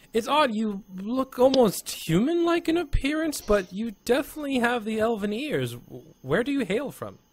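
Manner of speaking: curious Arthorn. Acted. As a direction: question the newcomer in a high, curious lilt.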